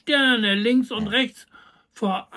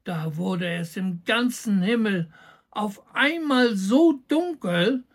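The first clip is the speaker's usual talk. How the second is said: plain reading voice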